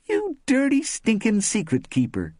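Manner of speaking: high, loud playful squeal of accusation; playful mock scolding. A narrator's reading. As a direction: tease a friend with a high-pitched scolding. Mock scolding a friend at party.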